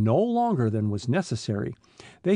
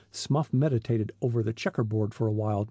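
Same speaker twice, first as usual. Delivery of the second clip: usual speaking voice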